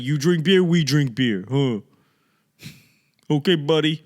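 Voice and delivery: hammed-up Boston local; cocky Boston guy. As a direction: scoff at every city like a hometown loudmouth. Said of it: "you're like".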